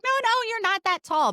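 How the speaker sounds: high, lightly mimicked mother; mom's voice. Mom dismissing her height, a high mock-mother voice. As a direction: brush it off like mom. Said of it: "And she's like".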